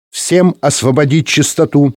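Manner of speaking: scratchy director on the radio; slow creaky voice. A narrator's reading. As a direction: creak out an order to everyone. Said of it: "раздался медлительный скрипучий голос".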